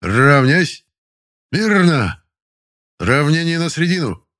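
loud drill commands, pitched high; command shout. Acted. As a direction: bark out parade commands. Строевые команды на плацу. (громкая строевая команда)

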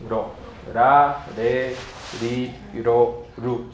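ro, ra, re, ri, ro, ru with a single-tap r; soft r. Shown as a demonstration. Teacher lists Esperanto syllables, ra re ri ro ru softly tapped. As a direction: tap each r lightly in ra, re, ri, ro, ru. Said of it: "en el esperanto es suave".

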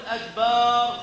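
أكبر drawn out as "akbaaar"; added alif. Shown as a demonstration. Teacher demonstrates an error, extra alif after the ba. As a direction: hold a long A after the b in أكبر. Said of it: "الألف التي تأتي بعد الباء".

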